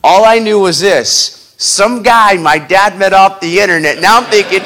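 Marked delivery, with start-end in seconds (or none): none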